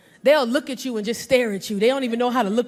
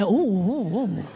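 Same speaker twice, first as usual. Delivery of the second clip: leering ooh; 6 semitones lower, much slower than usual. Retold or imitated. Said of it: "we be looking like".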